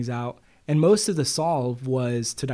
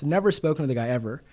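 usual speaking voice

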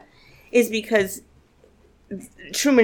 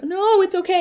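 higher, half-joking tearful self-soothing; mock-choked-up reassurance, pitched up; mock tearful comfort; 11 semitones higher than usual. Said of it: "I'm like".

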